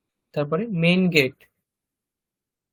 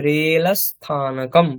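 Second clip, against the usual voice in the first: slow modeling; slower than usual. Shown as a demonstration. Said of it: "teacher models Sanskrit word".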